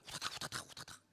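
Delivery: whispered hurried footsteps; scurrying noise. Retold or imitated. Father mimicking kids scrambling to hide, hushed. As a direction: mimic the kids' rushing feet, hushed.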